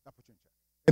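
hushed, breathy whisper of the same line. Shown as a demonstration. Preacher repeating it to the congregation in a whisper. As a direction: lean in and whisper it like a secret.

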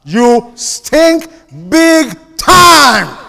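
loud, high, punching shout; preaching shout. Real, not acted. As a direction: hammer it home to the crowd. Preacher scolding congregation.

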